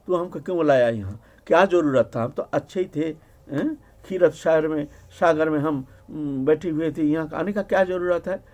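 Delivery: ordinary talk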